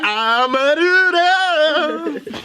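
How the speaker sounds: high, very slow stretched voice; drawn-out mock voice. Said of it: "She said you got".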